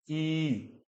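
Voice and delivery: "ई" drawn out long; long vowel. Shown as a demonstration. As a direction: hold "ई" for two beats. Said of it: "इसको simple इतना नहीं बोलना".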